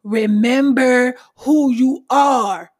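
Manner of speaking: slow, a touch of a regal lion-king father; imitating Mufasa. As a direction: stretch it out like a wise king's command. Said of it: "Mufasa said that shit best".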